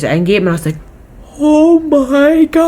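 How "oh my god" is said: high, slow, drawn-out gasp of awe; amazed. Retold or imitated. Amazed after watching Endgame.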